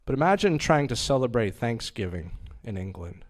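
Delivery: usual speaking voice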